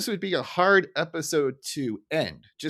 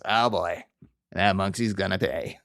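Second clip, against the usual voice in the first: defeated Frink; 7 semitones lower than usual. Retold or imitated. Mild defeated grumble from Frink about the monkey. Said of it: "just defeated goes".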